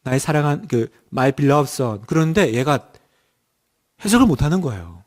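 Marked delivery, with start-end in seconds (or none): 0.0-2.0 "my beloved son" read slowly, haltingly, stress on "beloved"; hesitant child's reading. Shown as a demonstration. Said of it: "아, 근데 이렇게".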